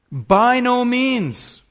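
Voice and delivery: loud, slow, high "By no means", hammering "no"; emphatic proclamation. Acted. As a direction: thunder the denial from the pulpit. Preacher repeats emphatic denial.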